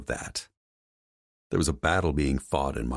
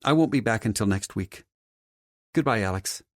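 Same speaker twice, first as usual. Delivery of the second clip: lightly voiced woman, higher than the narrator; slightly raised character voice for a polite goodbye; departing woman in story; 8 semitones higher, 4 dB louder than usual. Woman tells Alex she'll return next week, lightly voiced character.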